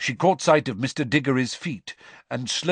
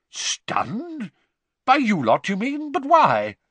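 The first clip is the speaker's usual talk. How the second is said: high-pitched disbelieving questions; astonished; 12 semitones higher, 4 dB louder, slower than usual. A narrator's reading. Bagman questioning Crouch about his elf, astonished. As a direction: pitch up in bewilderment.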